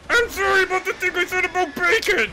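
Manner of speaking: hammed-up terrified plea; mock begging. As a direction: beg for mercy like a panicked cartoon pig. Pig begging for mercy.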